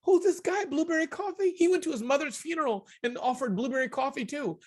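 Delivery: high-pitched, puzzled and irritated; annoyed customer. Retold or imitated. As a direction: play her as fed up with the same pitch again. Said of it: "She went".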